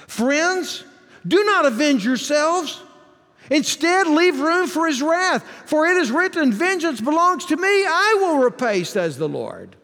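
high, ringing pulpit reading; preached scripture reading. Real, not acted. Preacher reading God's warning on vengeance to friends, high preached scripture. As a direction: lift the verse high, then settle on the last words.

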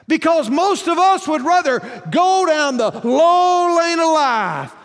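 shouted, high pulpit cry; full-force preaching. Real, not acted. Preacher rebuking the church for living like the world, shouted preaching. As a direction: drive it out loud, stretching the last words.

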